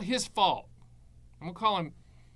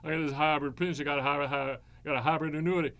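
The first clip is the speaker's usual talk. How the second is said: dopey agent voice; 3 semitones lower, faster than usual. Retold or imitated. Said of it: "if someone ... said".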